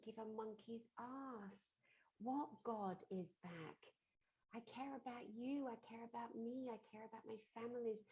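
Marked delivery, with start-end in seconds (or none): none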